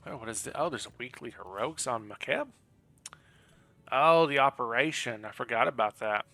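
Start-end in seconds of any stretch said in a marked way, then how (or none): none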